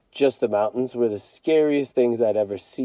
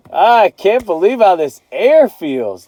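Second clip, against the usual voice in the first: marveling dad; 7 semitones higher, 5 dB louder, slower than usual. A narrator's reading. Dad marvels at mountain air.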